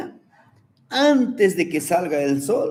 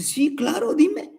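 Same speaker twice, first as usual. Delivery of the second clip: high mother voice; 12 semitones higher than usual. Acted.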